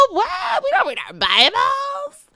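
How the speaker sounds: high, loud shrieking mock disbelief; mock scoffing girl. Friend scoffing at Bible study, shrieking mock disbelief. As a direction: shriek it like a scandalized friend mocking you.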